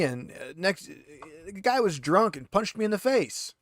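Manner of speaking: a hint of a rattled, defensive prisoner pleading innocence; lightly voiced handcuffed thug, indignant then wheedling. GM as the interrogated prisoner, lightly voiced and defensive.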